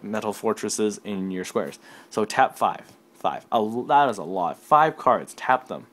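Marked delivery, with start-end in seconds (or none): none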